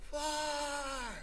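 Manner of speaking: high, drawn-out far-away call; mock scream. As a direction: imitate a scream without full volume. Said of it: "he would scream".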